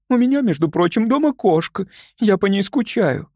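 high, plaintive homesick voice; tearful. A narrator's reading. Young woman missing her cat at home, tearful. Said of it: "всхлипнула Любочка".

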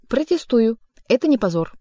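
yelling. A narrator's reading. The cat defending Margarita, loud indignant yell. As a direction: bawl out your protest. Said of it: "орал кот".

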